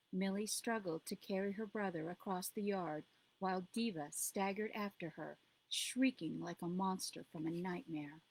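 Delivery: usual speaking voice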